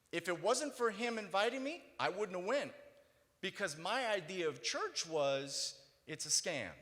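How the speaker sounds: testimony delivered at full voice; preaching. Acted. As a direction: keep the pulpit energy up. Preaching continues, loud and high.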